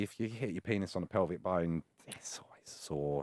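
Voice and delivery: whispered aside; hushed confiding. Real, not acted. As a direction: let them in on a secret. Confiding embarrassing detail.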